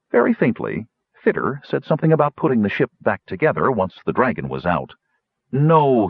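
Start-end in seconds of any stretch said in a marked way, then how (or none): 5.5-6.1 sharp, loud no; firm refusal. Acted. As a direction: shut it down firmly. Kitiara flatly refuses.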